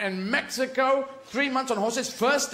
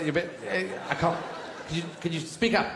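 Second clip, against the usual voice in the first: ordinary talk, nothing marked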